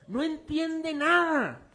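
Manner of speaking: very slow, high, stretched nada; drawn out emphasis. Acted. Preacher stresses Checo understands nothing, drawn out. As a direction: stretch every word.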